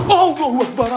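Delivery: sudden high loud exclamation; frightened high cry to God; startled cry. Real, not acted. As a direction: gasp it out in fright.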